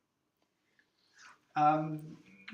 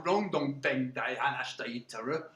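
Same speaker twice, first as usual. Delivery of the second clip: lightly put-on Cockney; Cockney accent; 7 semitones lower, 4 dB quieter than usual. Shown as a demonstration. Teacher shows class how Cockney speakers sounded, Cockney accent. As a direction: demonstrate rough Cockney talk. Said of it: "if they talk like that".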